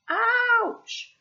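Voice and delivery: sudden high, loud yelp of pain; real ouch. Real, not acted. Bitten by the bird while reading, sharp high yelp.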